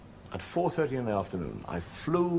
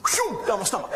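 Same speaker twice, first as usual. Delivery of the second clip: excited; 10 semitones higher than usual. Acted. Shark-finger prank punchline.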